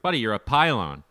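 loud mock chirp; hockey taunt. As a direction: call out a bad defender, mockingly loud. Taunting a bad hockey defender.

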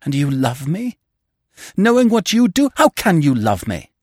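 high, loud outburst from a distraught woman; savage, accusing question; almost savage. A narrator's reading. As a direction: turn on him fiercely, pitch leaping up. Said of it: "turned on me almost savagely".

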